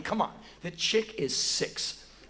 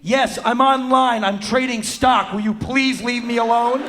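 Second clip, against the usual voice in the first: exasperated yell; 7 semitones higher, 10 dB louder than usual. Retold or imitated. Six-year-old trading stock wants him gone, exasperated yell. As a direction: yell it like a fed-up kid. Exasperated girl yells, retold.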